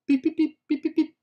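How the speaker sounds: high, loud sung beeps like a news intro; mock news jingle beeps.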